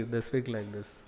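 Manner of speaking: very soft, hand over the mouth; soft and humble, not speaking directly at him; humble hushed speech. Shown as a demonstration. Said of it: "they put the hand like this and speak".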